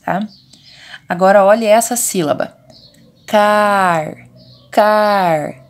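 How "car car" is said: CAR with a long, open A; drawn-out vowel. Shown as a demonstration. Teacher letting children hear the A, drawn-out CAR. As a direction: stretch the A in CAR before the final R. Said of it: "É o som do A".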